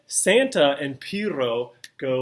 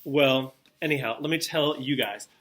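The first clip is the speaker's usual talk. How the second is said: ordinary talk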